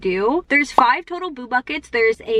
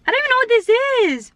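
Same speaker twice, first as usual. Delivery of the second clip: surprised; 12 semitones higher, much faster than usual. Real, not acted. Finds something on nugget.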